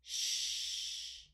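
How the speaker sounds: "Sh" as a sustained rush of air; long sh sound. Shown as a demonstration. Teacher naming the digraph, long "Sh". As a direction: hold "Sh" without voice. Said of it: "The sound is long".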